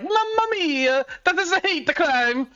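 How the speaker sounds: high, mock-outraged Italian accent; offended Italian. Italians reacting to weird pizza toppings, mock-outraged Italian accent. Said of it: "you bring that shit to fucking Italy".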